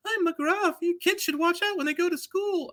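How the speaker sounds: very high, whiny cartoon voice; mock the new McGruff as Mister Bill; squeaky mocking Mister Bill impression. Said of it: "you sound like Mister Bill".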